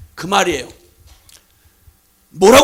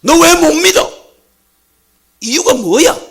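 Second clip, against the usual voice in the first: rebuke; 6 semitones higher, 7 dB louder than usual. Acted. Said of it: "주님이 물으시면".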